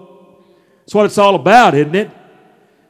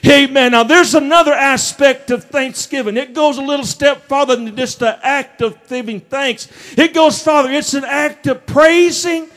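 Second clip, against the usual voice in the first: preaching at full force; 6 semitones higher than usual. Acted. Pastor firing up the congregation about Thanksgiving, full-throated preaching. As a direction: lift the room with a preacher's holler.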